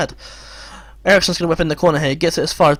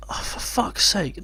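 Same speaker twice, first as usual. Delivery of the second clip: slow, breathy, drained curse; hushed, slow resignation; whispered despair; 3 semitones higher, 7 dB quieter, much slower than usual. Real, not acted. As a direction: let it out under your breath, beaten. Quiet despair after a goal.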